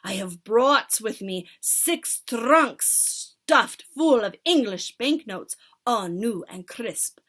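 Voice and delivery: loud witch leader giving orders; commanding witch. Acted. Witch leader instructing her followers, loud. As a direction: command the crowd grandly.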